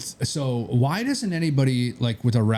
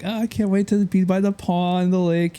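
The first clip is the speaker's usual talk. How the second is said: higher, lightly hammed-up excitement; mock gushing buyers; 9 semitones higher than usual. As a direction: play the buyers' dreamy gush, pitched up. Buyers voiced as gushing, retold.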